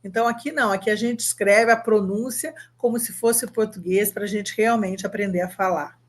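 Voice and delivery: ordinary talk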